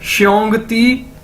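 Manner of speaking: श्योंग ती said slowly, shyong ti; slow, careful teacher demo; slow drill. Shown as a demonstration. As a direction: join श्योंग ती slowly for the class. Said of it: "भाई की क्या Chinese थी".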